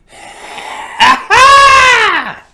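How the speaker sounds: high, loud whooping laugh; shrieking laugh. Real, not acted. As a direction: burst into a screaming laugh. Whoops over money sound.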